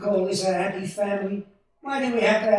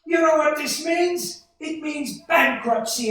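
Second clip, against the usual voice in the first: loud, higher, slow furious yell; furious shout; 7 semitones higher, 6 dB louder than usual. Acted. George screaming at Uncle Billy over lost money, furious shout. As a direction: tear into him with rage.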